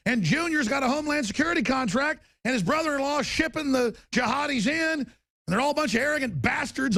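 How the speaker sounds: high, shouted outrage; ranting shout. Real, not acted. Host ranting to listeners about the shooter's family, shouted. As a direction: yell it out in fury.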